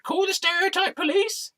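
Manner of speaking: squeaky falsetto mockery; high mocking taunt. Storyteller daring the objector to act, high mocking taunt.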